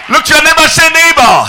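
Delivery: very high, loud, drawn-out preacher's shout; shouted proclamation. Acted. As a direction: proclaim it slowly to a roaring crowd. Preaches at full force.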